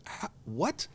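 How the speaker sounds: retold shocked reply to the backpedaling rep; incredulous squeal. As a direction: stammer out total disbelief. Mock disbelief at backpedaling.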